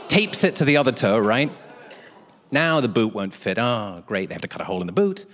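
ordinary delivery

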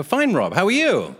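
high, overbright mock cheer; feigned all-is-well greeting; falsely chipper. Feigns being fine to director.

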